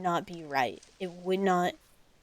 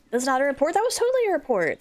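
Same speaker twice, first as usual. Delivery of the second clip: high, fast, louder protest; mock indignant; 6 semitones higher, 3 dB louder, much faster than usual. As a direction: insist it, much higher and quicker. Defending the useless report.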